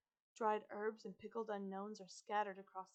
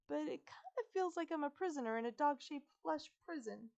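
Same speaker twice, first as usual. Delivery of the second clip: put-on higher, worried girl's voice; fretting girl-turned-dog; 6 semitones higher, 4 dB louder than usual. A narrator's reading. Girl zapped into a dog explains, fretful. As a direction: rattle out the worries in a raised pitch. Isabella frets about being a dog.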